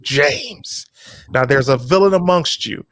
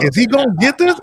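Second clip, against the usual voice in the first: high anxious quote; 10 semitones higher, faster than usual. Retold or imitated. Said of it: "I was like".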